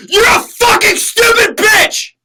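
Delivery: high, loud crying scream; screamed line. Shown as a demonstration. Actor demonstrating a director's line, screaming. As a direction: scream it through tears, slow. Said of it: "say this line like this".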